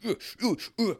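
high-pitched awkward mock grunts; mock grunting. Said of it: "you need to grunt more".

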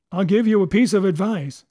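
doctor addressing the approaching cook. A narrator's reading. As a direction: call advice over to someone. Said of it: "he continued".

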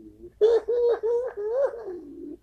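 scornful howl of laughter; loud high laugh. Real, not acted. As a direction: burst out laughing at the jab. Laughing at own taunt.

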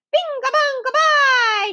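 high, loud gleeful chant; shouted. A narrator's reading. Small boy shouting nonsense to make noise. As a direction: yell it just to make noise. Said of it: "shouted the others".

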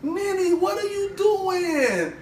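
high, slow, drawn-out protest; long wailing acted complaint; retold exasperation. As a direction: stretch it out high in mock dismay. Retold exasperated reply to sister.

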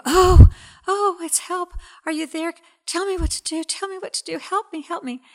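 a man dangling from a cliff begs for help, panicked; frantic pleading. Retold or imitated. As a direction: plead desperately from the cliff edge. Joke: frantic man calling for help.

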